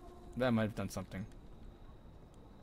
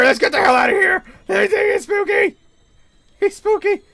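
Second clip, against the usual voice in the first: scared shout; 14 semitones higher, 8 dB louder than usual. Real, not acted. Fleeing monster, shouting.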